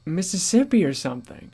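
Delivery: ordinary talk, nothing marked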